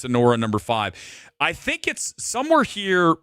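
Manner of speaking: ordinary delivery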